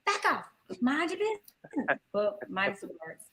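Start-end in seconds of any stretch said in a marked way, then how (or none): 0.1-2.0 Ashley from Fresh Prince. Retold or imitated. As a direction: snap it like a spoiled teen. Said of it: "like, Ashley on Fresh Prince".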